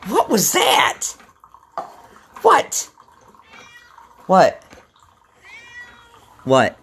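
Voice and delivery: answering the cat. Real, not acted. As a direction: talk back at the cat like it's a person. Answering his yelling cat.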